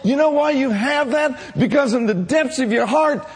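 building to a shouted climax; preaching. Real, not acted. Preaching builds to full force.

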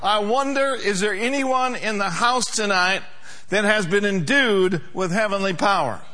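ordinary delivery

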